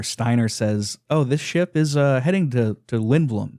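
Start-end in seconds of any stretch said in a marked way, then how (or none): 1.1-3.6 halting, stalling with uh's; badly faked nonchalance, stumbling over the words; bad liar. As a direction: fumble for a cover story. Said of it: "profoundly funny display of being a bad liar".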